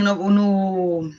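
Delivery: ordinary talk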